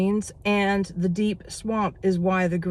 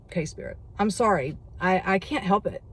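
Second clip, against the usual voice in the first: ordinary talk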